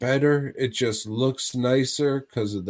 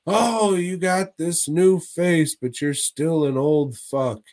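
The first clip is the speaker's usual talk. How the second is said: mock exclamation; 6 semitones higher, 4 dB louder than usual. Voicing the woman in a retelling.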